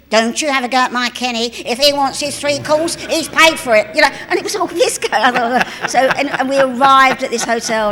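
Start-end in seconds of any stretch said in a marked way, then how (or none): 0.1-3.9 a mother snapping back, louder and higher, slowed down; protective mum telling off. Retold or imitated. Kenny's mum scolds the speaker for criticising her son, loud and indignant.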